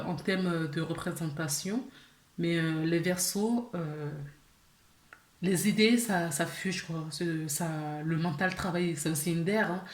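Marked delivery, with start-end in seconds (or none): none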